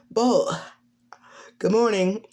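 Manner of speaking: high-pitched character voice; Cammy. Acted. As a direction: lift the pitch for a morning greeting. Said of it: "Cammy walks down".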